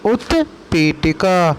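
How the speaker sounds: उत्पीठिका repeated slowly, syllable by syllable; उत्पीठिका धीरे से दोहराएँ. Shown as a demonstration. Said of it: "उसे हम बोलते हैं उत्पीठिका".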